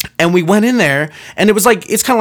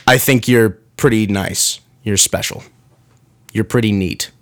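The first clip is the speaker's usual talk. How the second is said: sincere translation; 6 semitones lower than usual. Retold or imitated. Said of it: "the three go-tos for people saying".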